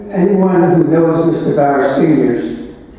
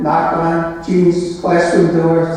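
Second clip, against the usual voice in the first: usual speaking voice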